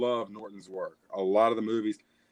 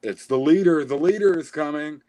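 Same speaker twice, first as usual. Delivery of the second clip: excited announcement; 3 semitones higher, 7 dB louder than usual. Retold or imitated. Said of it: "made me say".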